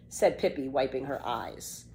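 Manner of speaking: plain reading voice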